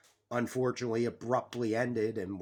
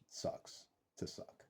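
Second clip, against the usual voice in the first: whispered taunt; 16 dB quieter, slower than usual. Real, not acted. Taunting rival Dallas fans.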